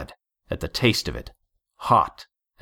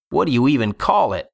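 quick, higher-pitched demand; 5 semitones higher, faster than usual. A narrator's reading. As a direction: press for an answer, fast. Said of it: "he demanded".

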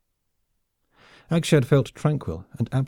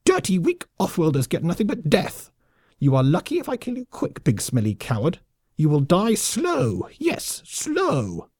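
squeaky lemming threat; 8 semitones higher than usual. Acted. Lemming colonel threatens the off-worlder, high gloating squeak. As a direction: drag out the threat with relish.

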